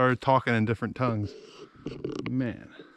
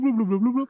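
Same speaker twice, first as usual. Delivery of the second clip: higher bubbly "bloop, bloop, bloop, bloop" like a gurgling bird; "bloop" repeated in a higher, gobbling, guttural imitation; imitating crow bloops; 11 semitones higher, 4 dB louder than usual. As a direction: mimic the crow's "bloop" sounds, rising and bubbly. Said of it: "it was going bloop".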